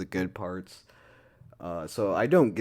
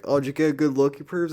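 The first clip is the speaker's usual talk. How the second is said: higher, louder sassy taunt at the creeps; mocking bold girl; 7 semitones higher, 9 dB louder, faster than usual. Girl in pool taunts bush creeps, sassy loud mockery. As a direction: flaunt it, calling out the pervs.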